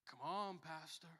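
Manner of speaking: imitated belittling reproach; mock someone using the title as a put-down; derogatory. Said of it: "in, like, a derogatory way".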